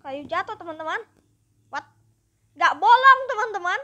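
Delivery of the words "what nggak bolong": ordinary delivery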